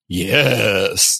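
a low attempt at the Megatron yes. Host tries Megatron's yes for the guest, low growl. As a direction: give the villain's yes a try on request. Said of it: "Give it a shot".

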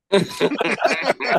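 high burst of laughter; amused laughter. Real, not acted. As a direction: crack up after your own punchline. Laughs after own punchline.

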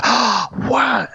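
high excited mock gasp; hammed-up amazed kids; kids' gasp. Host voicing the kids hearing of Santa, high excited. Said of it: "And they all go".

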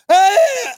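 high, drawn-out mock cry; mock choked cry. Joking about a man being choked, high cry. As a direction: play-act a high yelp while being choked.

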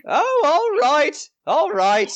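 relent in a high crabby voice; grudging old lady gatekeeper. Acted. Gatekeeper lady giving in to travellers, grudging high voice.

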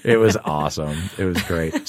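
usual speaking voice

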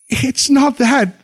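a bride close to tears; sobbing. A narrator's reading. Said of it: "she answered, sobbing".

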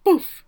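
loud, high imitated impact; mic-drop boom. Podcaster capping the wife's exit, imitated mic drop. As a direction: punch out a mic-drop noise. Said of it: "and mic drop".

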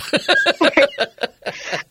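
high loud laugh. Real, not acted. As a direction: laugh at herself. Laughing after her punchline.

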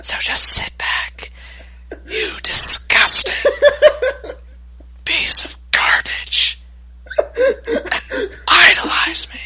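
hammed-up breathy ASMR parody; mock ASMR whisper. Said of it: "So that was sort of my impression".

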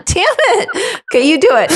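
ordinary delivery

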